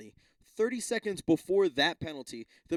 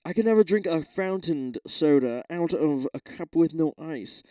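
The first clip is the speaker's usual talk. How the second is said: lightly voiced prissy podcast guest; mock someone being precious about ice; a hint of a fussy podcaster; mocking podcast guy; 2 semitones higher, 6 dB louder than usual. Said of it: "someone was like".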